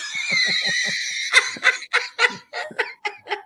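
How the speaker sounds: long, high-pitched cackling laugh; delighted laughter. Real, not acted. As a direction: laugh hard, high and helpless. Delighted by the donation sound.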